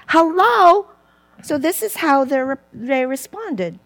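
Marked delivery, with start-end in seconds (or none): 0.1-0.9 a high, louder, disbelieving "Hello?"; mock incredulous hello. Preacher mocking the disciples for their thinking, mock disbelief. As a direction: poke fun at the disciples' answer.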